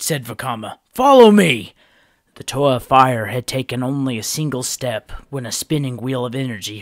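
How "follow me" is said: high, loud rallying cry; rallying call. A narrator's reading. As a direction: call the team to move out. Vakama rallying the team.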